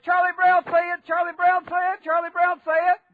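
put-on high, loud toddler chant; imitated two-year-old. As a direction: keep insisting like a little kid, loud and high. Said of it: "he just kept saying".

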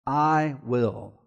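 1 hushed emphasis. Acted. Hushed contrast after indictment.

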